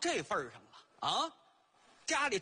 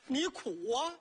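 ordinary talk

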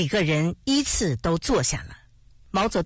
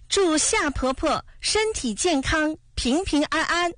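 put-on high, loud child's birthday cheer; loud shout; 10 semitones higher than usual. A narrator's reading. Li Na, kneeling, shouts wishes to granny Xia, loud and high. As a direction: call out the wishes at full voice, pitched high. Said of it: "李娜大声喊着".

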